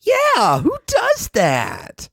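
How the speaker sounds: high, bouncy cartoon pony. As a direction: play a pony griping about a friend. Voicing a pony complaining.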